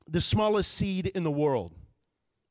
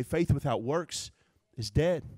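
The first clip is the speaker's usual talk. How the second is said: normal talking voice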